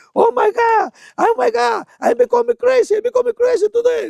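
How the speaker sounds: very high, loud, shrieking English cries; high-pitched frightened foreigner yelling oh my God; panicked friend. Retold or imitated. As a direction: squeal it out like a terrified onlooker.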